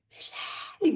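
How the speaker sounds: high, breathy call of a name; half-voiced panicked cry for the mother; retold yell. Running to the hospital, she calls for the mother in a breathy, high mock yell. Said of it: "Gritava assim".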